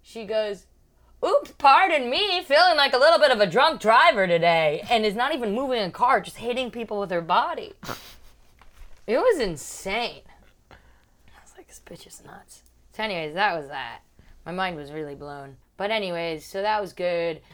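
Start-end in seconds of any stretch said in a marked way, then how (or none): 1.2-4.8 high, loud tipsy sing-out; mock tipsy apology, raised voice; drunk flight attendant. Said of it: "is blackout drunk". 10.7-12.6 very soft, voiceless whisper; hushed, whispered verdict; whispered aside. Retold or imitated. Aside about the attendant.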